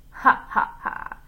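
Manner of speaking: spaced-out "ha, ha, ha"; hammed-up gloating laugh; villain's mock laugh. A narrator's reading. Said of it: "he laughed".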